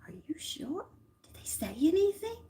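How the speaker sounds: whisper, eager for the truth; pressing whisper. Retold or imitated.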